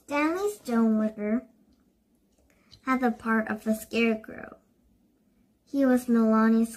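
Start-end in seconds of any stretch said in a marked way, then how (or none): none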